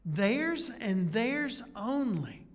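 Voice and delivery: very slow "Theirs and theirs only", each word pressed; emphatic theirs. Shown as a demonstration. Preacher repeating the emphatic pronoun for the congregation, slow stress. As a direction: drag out "theirs" and "only" to make them exclusive. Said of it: "emphatic pronoun".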